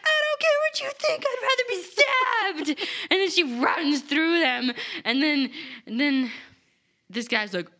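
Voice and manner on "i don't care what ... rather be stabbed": high, fast hammed-up defiance; high defiant rush. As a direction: fire it off stubbornly. Lila refuses, voiced.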